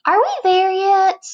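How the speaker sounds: high, loud, whiny complaint; whiny impatient butterfly. Acted. Impatient butterfly asking his friend on the trip, high and whiny. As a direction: whine it like a bored kid in the back seat.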